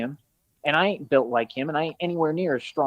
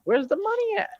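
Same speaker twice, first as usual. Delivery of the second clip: squeaky high mocking taunt. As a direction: jeer it way up high, teasing. Mocking the chat for donations.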